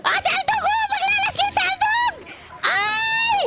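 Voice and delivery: frantic squealing far above normal pitch; high-pitched screaming. Real, not acted. Gamer caught in a fight, frantic high screaming. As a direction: scream every word at the top of your range.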